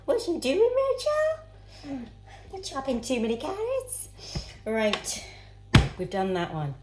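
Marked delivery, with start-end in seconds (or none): 0.1-4.1 imitating mum again. Rachel redoing her mum's nag about carrots, high and squeaky. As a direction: ham up the nagging mother in a very high voice. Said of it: "But I like that".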